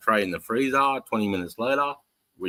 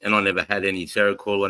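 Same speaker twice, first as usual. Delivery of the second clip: normal talking voice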